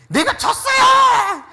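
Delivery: put-on very high, loud, drawn-out wail of a child; stretched, sobbing-loud cry of a sore loser; child's wailing shout. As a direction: howl it like a little kid who just lost a game. Child crying over lost game.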